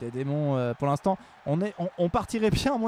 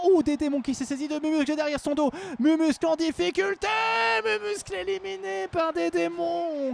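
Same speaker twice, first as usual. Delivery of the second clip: play-by-play; 9 semitones higher than usual. Acted. Live commentary of a fight.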